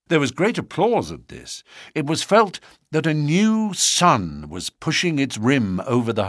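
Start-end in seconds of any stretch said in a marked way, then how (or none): none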